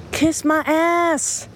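sassy kiss-off; slow sassy dismissal. Real, not acted. Host dismisses haters.

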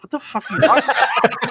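high, fast, laughing outrage; incredulous laughing retort; laughing disbelief. Retold or imitated. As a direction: blurt it out through a laugh. Retold disbelief at guard, laughing.

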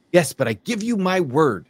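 lightly acted, earnest-sounding assurance; insistent promise. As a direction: play a scorpion swearing its good faith. Scorpion insists in acted fable.

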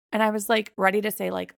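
ordinary talk

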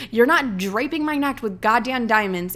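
normal talking voice

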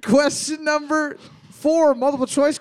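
host starts the next round; announcing. Acted. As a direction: announce like a game show.

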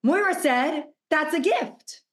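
high, slow, wondering voice; light up as if it suddenly clicks; modelling the client's joyful aha moment; delighted realization. Pretend. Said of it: "Literally go".

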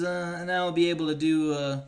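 ordinary delivery

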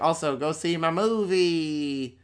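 drawn-out mock villain plug; Chucky. Chucky plugs his film to a wrestler, mock villain. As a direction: stretch it out in a silly doll voice. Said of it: "And he's like".

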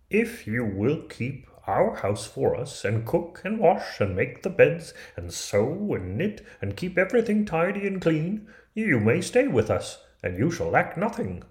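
high-pitched dwarf character voice; storybook dwarf, bargaining earnestly; dwarf making an offer. Acted. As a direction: lay out the terms in a high little dwarf voice.

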